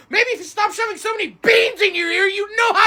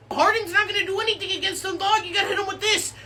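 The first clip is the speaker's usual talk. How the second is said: ordinary talk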